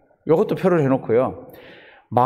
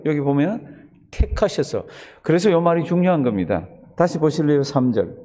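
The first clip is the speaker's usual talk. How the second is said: ordinary talk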